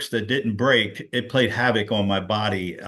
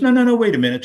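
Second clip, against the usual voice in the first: eager interruption; 11 semitones higher, 5 dB louder, much faster than usual. Retold or imitated. Cousin insists, retold excitedly.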